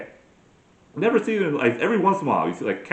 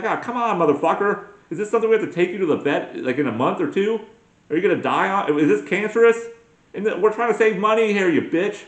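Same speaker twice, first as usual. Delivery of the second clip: exasperated rant at the dog; 7 semitones higher than usual. Acted. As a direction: chew out the dog like it's her fault. Yelling at dog's blister.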